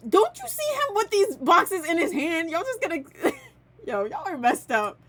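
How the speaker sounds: high, loud exasperation; loud disbelief at them; exasperated. Real, not acted. Exasperated at the other players.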